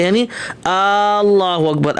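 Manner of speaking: اللہ اکبر with the opening hamza drawn out slowly; lengthened hamza. Shown as a demonstration. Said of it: "اگر مد کے حمزے پر". (حمزہ پر مد کے ساتھ)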